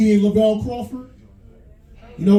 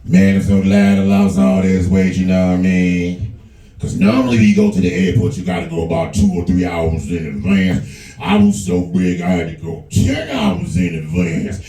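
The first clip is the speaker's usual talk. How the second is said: Lavelle Crawford; 14 semitones lower than usual. Retold or imitated. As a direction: rumble it low like a big man. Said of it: "This Lavelle Crawford".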